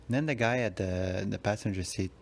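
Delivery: normal talking voice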